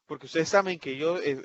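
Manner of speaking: usual speaking voice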